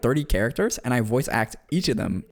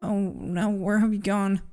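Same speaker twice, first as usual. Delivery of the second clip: put-on higher-pitched, anxious girl character; halting, higher voice of someone scared and confused; worried Mary; 10 semitones higher, 4 dB quieter than usual. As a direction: plead uneasily, pausing between phrases. Voices scared Mary calling Hamlet.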